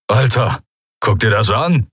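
lightly voiced Skria calling a mate; a mild call to come and look. A narrator's reading. Skria calling his mate over, mildly urgent. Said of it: "hörte sie einen Skria rufen".

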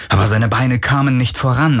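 usual speaking voice

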